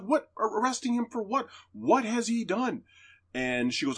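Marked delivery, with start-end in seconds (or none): 0.0-2.8 much higher, incredulous cry; man crying out in disbelief at the arrest; disbelieving protest. Retold or imitated. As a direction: protest in panic.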